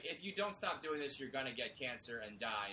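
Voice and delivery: normal talking voice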